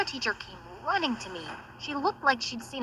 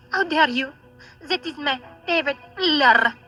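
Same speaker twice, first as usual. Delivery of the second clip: angry rant. Acted. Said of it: "she went crazy".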